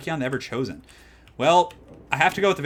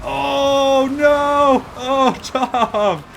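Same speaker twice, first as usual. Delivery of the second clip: real stunned shout; 14 semitones higher, 9 dB louder, much slower than usual. Real, not acted. Caster stunned by a big play.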